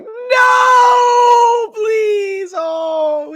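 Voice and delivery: high crying shout; crying plea. Retold or imitated. Crying out at Tony's death, high and loud. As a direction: wail it out in mock grief.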